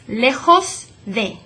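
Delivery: slow "lejos" with a throaty j like h; slow model Spanish. Shown as a demonstration. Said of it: "that J sound".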